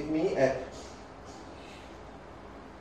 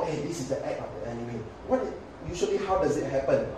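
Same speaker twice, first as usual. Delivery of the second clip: ordinary talk, nothing marked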